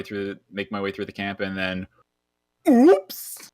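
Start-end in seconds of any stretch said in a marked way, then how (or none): 2.7-3.5 high, loud staged "oops"; mock oops. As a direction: make the stumble obvious and exaggerated. Acts out tripping with torch.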